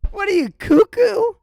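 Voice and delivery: mocking Trump. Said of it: "and be like".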